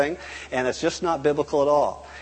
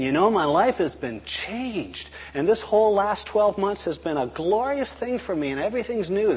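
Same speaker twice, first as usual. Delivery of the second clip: high, lilting, heartfelt enthusiasm; glowing testimony; 7 semitones higher than usual. Acted. Convert reporting a year of new life, glowing. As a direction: gush about a changed life. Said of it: "he's saying".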